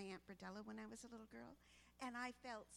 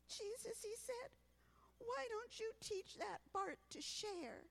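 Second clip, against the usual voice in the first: put-on little boy voice; 10 semitones higher, 3 dB louder than usual. Little boy praying to Jesus.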